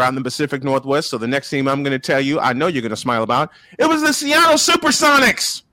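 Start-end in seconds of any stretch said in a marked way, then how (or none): 3.8-5.6 high, louder reveal; playful reveal. Real, not acted. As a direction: announce it with a grin. Teasing reveal to Northwest friend.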